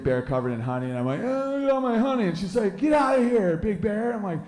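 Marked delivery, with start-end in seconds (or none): 1.2-2.3 much higher dopey bear; high dopey bear proud of his honey; goofy high bear voice. Acted. Bear husband voice. 2.8-4.1 mock wife. Wife kicks out bear.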